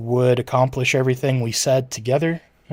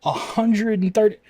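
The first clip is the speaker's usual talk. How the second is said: surprised; 9 semitones higher than usual. Real, not acted. Reacting to a viewer's takeout bill.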